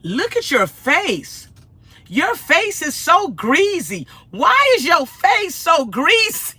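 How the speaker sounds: slow, high, drawn-out heckling; hammed-up loud, high mock horror; mock disgust. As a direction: imitate a troll, stretching every insult. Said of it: "they came back and said".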